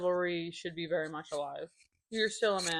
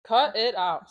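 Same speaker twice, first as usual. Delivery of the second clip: catchphrase impression, punched out louder; Joey Gladstone; 2 semitones higher, 7 dB louder, slower than usual. Podcast host recalling a sitcom uncle's catchphrase, Joey Gladstone impression. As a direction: hit each word like a sitcom catchphrase. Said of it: "He does, like, Joey from Full".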